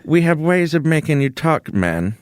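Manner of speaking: cool foreign spy menace; [name] impression. Shown as a demonstration.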